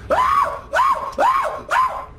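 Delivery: sharp high yelps of fright; startled screams. Real, not acted. Gamer shrieks at a jump scare. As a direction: scream in sudden panic.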